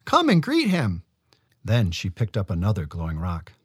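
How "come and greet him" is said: put-on young woman voice. A narrator's reading. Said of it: "Nolka said".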